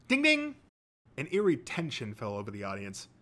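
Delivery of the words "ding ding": high-pitched, loud voiced buzzer ding. A narrator's reading. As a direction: chime a sudden high ding-ding. Narrator imitates the quiz buzzer.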